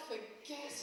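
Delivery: ordinary delivery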